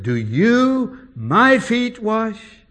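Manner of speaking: high, slow and indignant, each word weighted; offended disciple leaning on every word; emphatic Peter. Retold or imitated. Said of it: "it's very emphatic".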